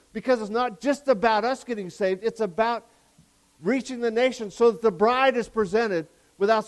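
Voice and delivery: loud, high-pitched preaching surge; excited preaching. Real, not acted. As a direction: get carried away, pushing the message out loudly. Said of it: "Sorry, I get excited".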